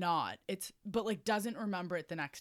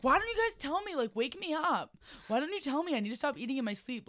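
high-pitched imitation of a scolding friend; mock yelling; 8 semitones higher, 4 dB louder than usual. Sleep-eater yelling at her friends, high mock complaint. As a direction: complain high, easing toward the end. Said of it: "she yells at us".

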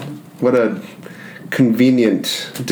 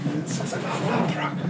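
hushed, breathy far-off voice; eerie soft echo; 5 semitones higher, 10 dB quieter than usual. Acted. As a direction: drift it out like an echo. Echoing voice in the mist.

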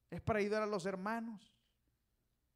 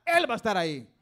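loud, raised-pitch burst of conviction; preacher's climactic shout; 4 semitones higher, 12 dB louder than usual. Real, not acted. Preacher promises congregation God will be there, climactic shout. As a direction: shout it out as the sermon's peak.

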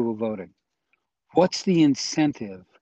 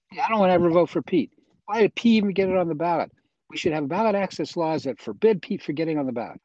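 higher, hurried mock protest; jokingly indignant, pitched up and quick; mock indignation; 6 semitones higher, faster than usual. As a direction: act out a voter griping in mock outrage. Acts out voter who hates Pete.

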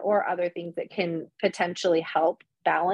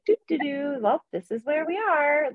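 higher, sing-song mock cheerfulness; Pleasantville resident; 7 semitones higher than usual. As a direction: play a clueless, contented fifties suburbanite. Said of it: "If we were all just like".

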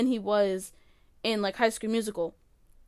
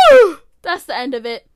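emphatic loud; 12 dB louder than usual. Real, not acted. Mock dramatic verdict.

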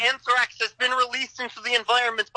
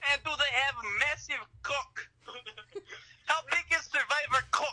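put-on Russian accent; 2 semitones higher than usual. Prank caller accent.

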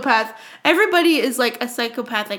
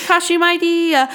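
ordinary talk

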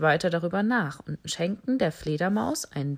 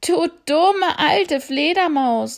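much higher, louder, slow scornful yell; mocking shout; 9 semitones higher, 8 dB louder, slower than usual. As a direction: scoff at the silly bat. Animal scolds the bat. (laut, höhnisch)

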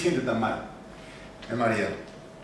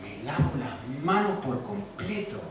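soft, slow, low aside; quietly absolve yourself, drawing it out; hushed, unhurried dismissal; washing hands of it; 5 semitones lower, 10 dB quieter, slower than usual. Acted. Acting out shrugging off guilt.

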